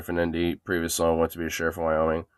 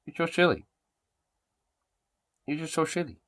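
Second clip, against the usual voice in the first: high fond coo; pet talk; 7 semitones higher than usual. Real, not acted. Owner calling her dog silly, high cooing voice.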